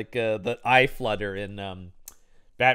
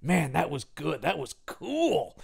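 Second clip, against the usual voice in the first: geeky awe; 3 semitones higher, 4 dB quieter, slower than usual. Retold or imitated. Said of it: "He goes".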